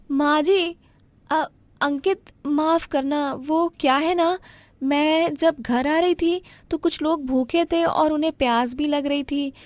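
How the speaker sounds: pitched well up, flustered daughter-in-law apologizing; young daughter-in-law. Acted. Daughter-in-law lies about charity.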